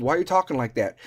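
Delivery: ordinary talk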